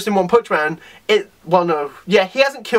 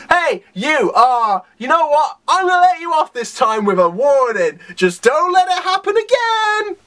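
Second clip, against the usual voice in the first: pitched-up scolding that climbs to the end; mock stern warning; 6 semitones higher than usual. Hero letting an offender off with a warning, mock stern telling-off. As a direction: let them off with a hammed-up telling-off. Acting out hero's funny warning.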